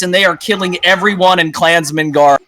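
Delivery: ordinary talk, nothing marked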